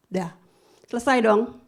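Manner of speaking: ordinary delivery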